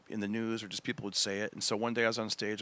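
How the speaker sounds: ordinary delivery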